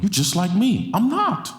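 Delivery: mock colorblind claim, then retort. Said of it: "And now you say".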